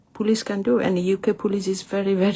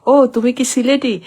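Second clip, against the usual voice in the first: higher, louder mock question from the caller; imitating a surprised caller; 6 semitones higher, 6 dB louder than usual. Translation caller asking if she's Sylheti, mock surprised. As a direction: play the Bengali caller on the phone, surprised she speaks Sylheti. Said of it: "তখন ওরা বলে কি".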